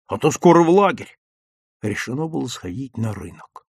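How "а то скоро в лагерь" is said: pleased, louder and higher; glad, lively lift; gladdened. A narrator's reading. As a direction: agree eagerly. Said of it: "обрадовался Лёвка".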